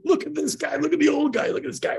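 high-pitched imitation of jeering boys; mocking kids. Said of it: "they're ripping on him".

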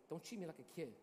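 a husband grumbling quietly to his wife; hushed protest. Retold or imitated. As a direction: mutter it like a sulking kid.

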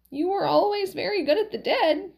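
put-on high, lilting old wife; high swooping voice, coaxing; Valerie, encouraging. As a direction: flatter the husband in a high lilt. Valerie encourages her husband.

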